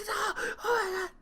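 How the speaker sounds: high, breathless mock panic; panicked. Retelling her panic to Tiff, high mock panic. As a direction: imitate your own panic.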